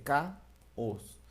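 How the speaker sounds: caos drawn out as two syllables, ca and os, very slowly. Shown as a demonstration. Teacher to class, slowly sounding out caos. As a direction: stretch caos out to let the hiatus be heard. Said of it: "este fenómeno se denomina hiato".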